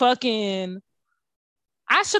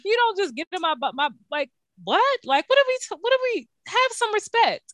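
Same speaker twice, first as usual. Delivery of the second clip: much higher, exasperated scolding; indignant rant; 8 semitones higher than usual. Real, not acted. Host scolding men who slide into her inbox, indignant. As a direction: demand some respect.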